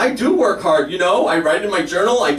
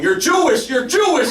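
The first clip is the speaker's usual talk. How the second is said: mock yell; 8 semitones higher than usual. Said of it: "keeps yelling".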